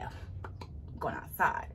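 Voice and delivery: slow secret whisper; hushed, slow aside. Shown as a demonstration. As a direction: breathe it like a secret.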